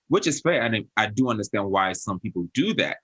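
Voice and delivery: ordinary delivery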